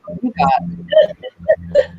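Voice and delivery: high drawn-out voice. Acted. Drawn-out prompt.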